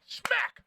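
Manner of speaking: sharp high "Smack!" impact noise; smack sound effect. Retold or imitated. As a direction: slap the word out like a hit. Window hit, imitated noise.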